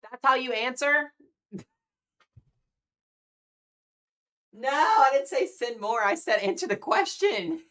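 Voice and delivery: loud, high, slow mock scolding; mock outrage. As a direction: playfully yell at her for overdoing it. Teasing a donor's oversized gift.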